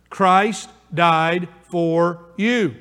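very slow, each word stretched; drawn-out personal application; slow emphatic. Shown as a demonstration. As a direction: drive it home word by word. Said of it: "change these words".

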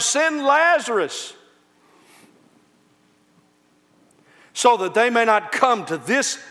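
high, loud fire-and-brimstone preaching; full-force preaching. Acted. Preacher warning the congregation of hell, full-force preaching. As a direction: thunder it from the pulpit like a revival preacher.

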